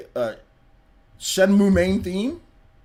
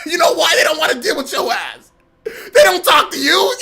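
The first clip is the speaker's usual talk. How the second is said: mocking Twitter troll; 7 semitones higher, 4 dB louder than usual. Streamer mocking a rude Twitter commenter in a high whiny voice. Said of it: "That's what somebody says".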